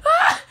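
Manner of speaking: sudden high startled scream. Acted. Roommate hears a crash and shrieks in fright. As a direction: shriek as if something crashed nearby.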